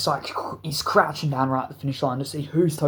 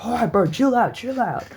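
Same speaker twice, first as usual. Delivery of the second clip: imitated calming brother; 7 semitones higher, 3 dB louder than usual. Brother restrains the fighter.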